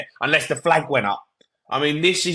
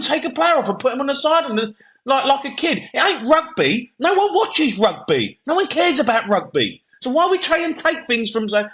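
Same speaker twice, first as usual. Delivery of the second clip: furious rant; 8 semitones higher than usual. Real, not acted. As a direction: vent your fury at the new rule, pitch climbing. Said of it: "I like to see you like that, that powerful".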